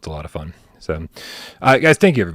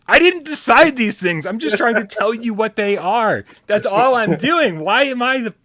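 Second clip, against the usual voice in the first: higher, slow, put-upon whine; exasperated whining; 12 semitones higher than usual. Real, not acted. Host whining to chat about being blamed, exasperated. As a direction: plead your innocence, exasperated.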